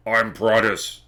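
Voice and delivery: booming deep character voice; big, deep, drawn-out boom; Prattis. Acted. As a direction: announce yourself in a long, booming voice. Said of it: "very booming deep voice".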